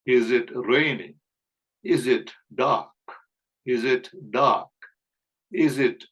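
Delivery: very slow, word by word; slow repeat-after-me. Shown as a demonstration. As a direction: model each question slowly for learners to repeat. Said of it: "பிராக்டிஸ்க்காக என் கூடவே சொல்லி பாருங்க". (மெதுவாக, சொல் சொல்லாகப் பயிற்சி)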